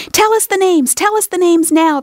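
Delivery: put-on high, loud eager clamour of children; children chorusing. A narrator's reading. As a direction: beg all at once like impatient kids. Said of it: "the children chorused".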